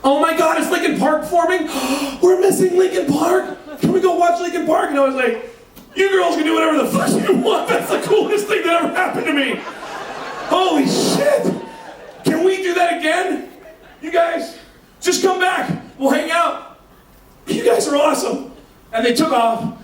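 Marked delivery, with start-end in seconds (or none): 0.0-4.9 put-on excited girl. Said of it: "I hear one of the girls going". 6.0-18.5 giddy laughing shout, much higher; elated. Retold or imitated. Thrilled reply to the girls.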